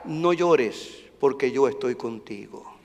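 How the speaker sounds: soft, low, close comfort; tender divine whisper. Acted. The Lord quietly comforting the hurting, tender. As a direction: speak it as God reassuring.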